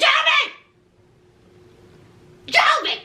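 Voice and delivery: mock calling across the house.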